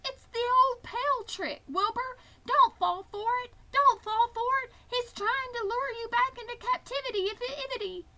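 very high, urgent goose voice; warning goose. A narrator's reading. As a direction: plead frantically in a high goose squawk. Goose warns against the pail trick.

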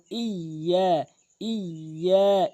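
slow ইয়া with a held double y; ইয়া drawn out, y doubled. Shown as a demonstration. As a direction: lean on the y in ইয়া. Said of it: "ইয়াটি তাসদিদযুক্ত double উচ্চারণ করতে হবে".

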